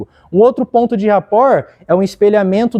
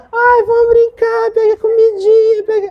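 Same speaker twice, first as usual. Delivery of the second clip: put-on much higher, slow little-kid voice; childlike voice. Shown as a demonstration. Coach shows how to play with a child, high slow kid voice. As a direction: come down to a child's level and sound like one. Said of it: "O que que é isso? É rapport".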